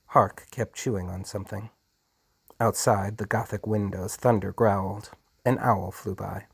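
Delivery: plain reading voice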